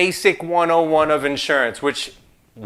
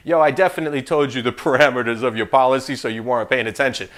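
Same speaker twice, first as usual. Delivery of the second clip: dismissive retort; 6 semitones lower, faster than usual. Retold or imitated. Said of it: "'cause I'll probably tell you".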